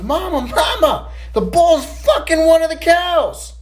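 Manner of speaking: bursting in to tell Mama; excited kid. Acted. Boy runs into the house shouting news to his mama.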